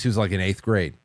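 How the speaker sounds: ordinary talk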